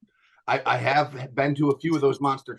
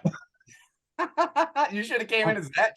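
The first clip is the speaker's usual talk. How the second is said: breaking up with laughter, high; laughing; 6 semitones higher, much slower than usual. Real, not acted. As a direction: laugh through the words. Laughing at Chad's face filter.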